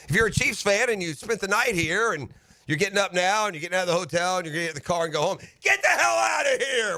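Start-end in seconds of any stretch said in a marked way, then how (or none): 5.6-7.0 gleeful taunt shout. Real, not acted. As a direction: shout the fans out of town with glee. Taunting Chiefs fans.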